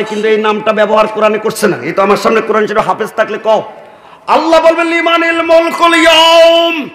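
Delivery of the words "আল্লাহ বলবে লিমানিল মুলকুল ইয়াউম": high, slow shouted chant; long-held, high declamation; full-force preaching. Real, not acted. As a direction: ring out God's proclamation to the crowd.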